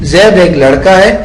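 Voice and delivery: ordinary talk, nothing marked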